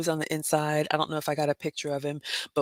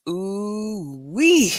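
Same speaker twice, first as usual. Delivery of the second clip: drawn-out ooh-wee; 4 semitones higher, 5 dB louder than usual. Real, not acted. Recalling the luxury store.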